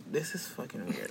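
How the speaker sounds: very soft, low muttered aside; awkward aside. Retold or imitated. Uncomfortable assistant reacting to the show, muttered aside. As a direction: drop it right down, as if not to be heard.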